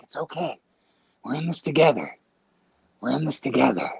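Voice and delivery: low, hushed comforting of an accomplice; mock reassurance. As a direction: settle down and soothe him conspiratorially. Soothing the cabbie while hiding.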